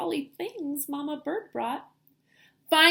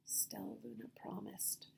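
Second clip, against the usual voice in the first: soft breathy whisper; whispered narration; 3 semitones lower, 21 dB quieter than usual. A narrator's reading. Teacher reading to kids, soft whisper of a meek promise. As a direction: whisper it meekly.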